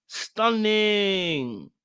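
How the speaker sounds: "Stunning" stretched long, starting high and gliding down; drawn-out emphasis. Real, not acted. Host admiring Tequila as a fine woman, "Stunning" drawn out. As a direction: draw out "Stunning" in a long falling glide.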